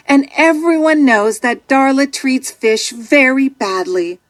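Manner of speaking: ordinary delivery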